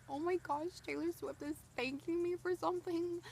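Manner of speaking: high, excited retold gasp; starstruck gushing. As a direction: gush like a starstruck fan. Retelling meeting Taylor, starstruck.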